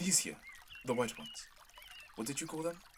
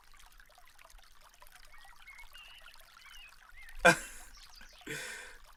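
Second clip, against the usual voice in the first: teasing laugh. Acted. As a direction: laugh gently at her blushing. Prince teasing her blush.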